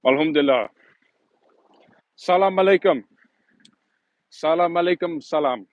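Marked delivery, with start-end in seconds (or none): 2.2-5.7 Salam alaikum in a careful Arabic accent; Arabic greeting. Shown as a demonstration. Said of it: "How's my Arabic?".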